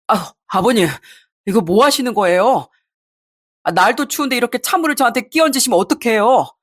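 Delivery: lightly voiced aggrieved husband; protesting husband. Husband to father-in-law after cold water, protesting. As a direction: complain in dismay as the doused husband.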